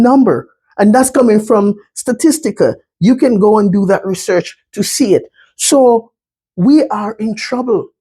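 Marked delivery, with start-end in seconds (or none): none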